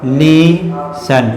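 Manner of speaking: "लीसन" split as lee... sun; "लीसन" very slow, long first syllable. Shown as a demonstration. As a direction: stretch "लीसन" slowly in two parts, no t. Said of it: "इसको लिशन पढ़ते हैं".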